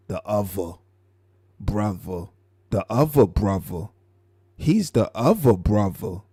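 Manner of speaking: "other brother" with V for both THs; up top accent. Retold or imitated. Showing it ain't the TH, "other brother" said with a V. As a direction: say "other brother" as "uvva bruvva". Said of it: "It ain't the T H, it's the V".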